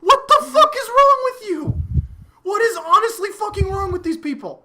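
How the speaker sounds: incredulous laugh-yell; laughing exasperation. Real, not acted. Reacting to cruel teammates, laughing exasperated outburst.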